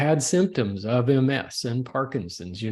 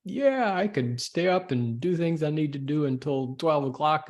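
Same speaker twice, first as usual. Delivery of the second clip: cocky younger self; 5 semitones higher than usual. Retold or imitated. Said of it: "it's like".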